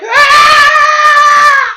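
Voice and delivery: piercing, very high mock baby wail; baby scream. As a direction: let out a long shrieking newborn cry. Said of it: "he's like".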